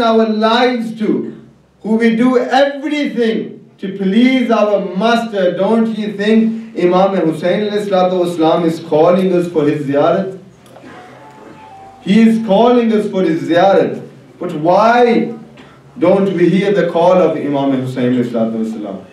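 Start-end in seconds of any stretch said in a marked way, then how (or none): none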